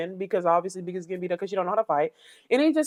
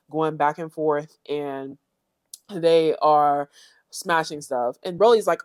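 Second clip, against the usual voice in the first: normal talking voice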